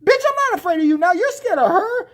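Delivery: high, loud, fast jeer; mock taunt. Storyteller taunting the bully now scared of mom, high-pitched jeer. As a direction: crow it with mock bravado.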